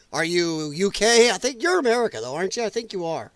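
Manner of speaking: ordinary talk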